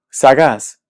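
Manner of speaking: sagaz said loudly as sa-GAZ; stress on last syllable. Shown as a demonstration. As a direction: land hard on GAZ in "sagaz". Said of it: "la sílaba tónica es la última".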